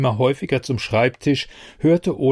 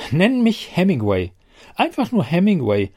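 higher-pitched, jaunty self-naming; cheerful, chipper tone; 5 semitones higher than usual. A narrator's reading. Talking desk grandly picks its own name, cheerfully. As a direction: announce it in high good humour. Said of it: "sagte er in aufgeräumtem Ton".

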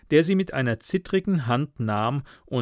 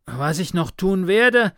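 higher, louder, winded words; breathless agitation; panting; 7 semitones higher, 5 dB louder than usual. A narrator's reading. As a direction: keep going while short of breath. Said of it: "keuchte Karl".

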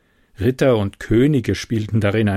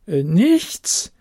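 hesitant, wavering "Äh, nichts"; less convinced; 6 semitones higher than usual. A narrator's reading. Loreen repeating her denial, now unsure. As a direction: doubt creeping in. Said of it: "mit etwas weniger überzeugendem Klang".

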